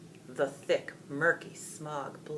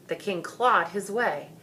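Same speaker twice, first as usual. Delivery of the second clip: ordinary delivery, nothing marked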